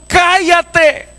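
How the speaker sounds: high, drawn-out order to be silent; crowd's shouted rebuke. Acted. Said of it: "cuando el ciego le dijeron".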